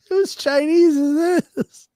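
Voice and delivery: high, slow, drawn-out question; nosy coworker. Retold or imitated. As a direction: play the guy, stretching every word.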